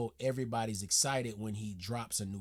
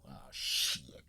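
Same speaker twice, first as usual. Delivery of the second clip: whispered oh shit; 13 semitones lower, 5 dB quieter than usual. Real, not acted. Shocked by hour-long runtime.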